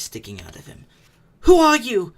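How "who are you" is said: startled cry. Acted. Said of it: "he said, thrusting his dagger".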